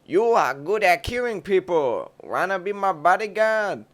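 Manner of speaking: put-on eager old man making a job offer, over the top. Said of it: "in an extremely racist voice".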